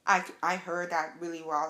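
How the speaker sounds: usual speaking voice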